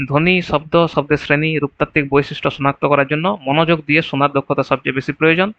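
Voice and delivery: usual speaking voice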